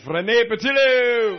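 loud, high, drawn-out shout of a name; big rising stage introduction; announcer introduction. Real, not acted. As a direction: bring her on like a ring announcer. Introduces his wife to the audience.

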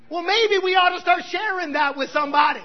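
loud, high rallying call; preacher's rousing cry; shouted exhortation. Acted. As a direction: shout it to stir the room.

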